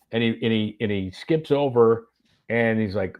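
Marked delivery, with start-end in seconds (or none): none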